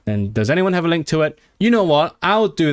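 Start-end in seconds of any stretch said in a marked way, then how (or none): 1.6-2.7 excited outburst. Real, not acted. Streamer rallying chat.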